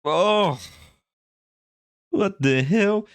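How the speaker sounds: high, exasperated outburst; indignant disbelief. Real, not acted. Exasperated rant about the show.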